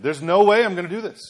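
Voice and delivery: exaggerated refusal. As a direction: overplay a stubborn no. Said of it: "We can exaggerate it".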